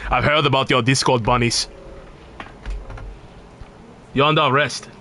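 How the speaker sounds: lightly put-on Nordic guard accent; a Skyrim guard accent, stern. Streamer parodying a Skyrim guard, lightly put-on Nordic accent. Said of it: "it's the fucking accent kills me".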